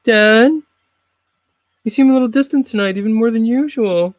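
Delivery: put-on a child nudging his distant dad; worried son. Son voiced in skit.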